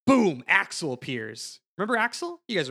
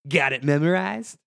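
quoting a catchphrase; 6 dB louder than usual. Retold or imitated. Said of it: "He goes".

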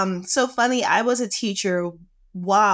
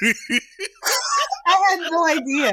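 laughing; 11 semitones higher, much slower than usual. Real, not acted. Host reveals he cyber-stalked her.

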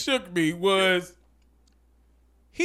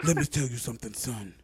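much lower, hushed threat; low menacing preacher; 12 semitones lower, 11 dB quieter than usual. Retold or imitated. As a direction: growl a calm churchy threat. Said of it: "He was like".

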